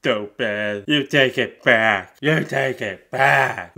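loud, slow put-on voice; slow, hammed-up insistence; huffy boyfriend. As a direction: demand it back, deeply offended.

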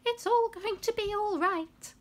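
very high, put-on creature voice; high-pitched shaking voice. A narrator's reading. Figure in the dark reassuring the visitors, shaking and high-pitched. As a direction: pitch it right up, eerily reassuring. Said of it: "said a shaking, high-pitched voice".

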